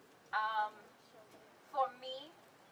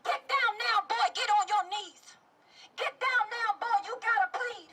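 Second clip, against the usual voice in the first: urgent, high-pitched rhythmic cries; anguished poem at full intensity; spoken-word protest poem; 10 semitones higher, 4 dB louder than usual. Acted. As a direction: drive the poem hard, commands turning to pleas. Poem voices officer and victim.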